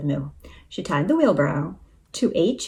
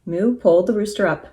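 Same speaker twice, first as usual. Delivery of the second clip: ordinary delivery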